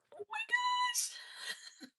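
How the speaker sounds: very high, tiny, drawn-out voice; slow, hushed, high-pitched disbelief; stunned squeak. Real, not acted. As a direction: squeak it softly in amazement. Device goes off suddenly.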